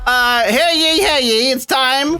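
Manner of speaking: high, ringing mock court crier; mock town crier.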